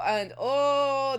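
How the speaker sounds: usual speaking voice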